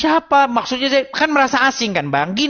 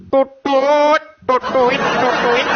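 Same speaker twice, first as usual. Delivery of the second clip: voiced Batak flute trill, rising at the tail; mimicked flute tootle; much slower than usual. Evoking the Batak flute far from home, vocal toots. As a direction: hoot a little flute tune with the lips. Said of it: "seruling Batak".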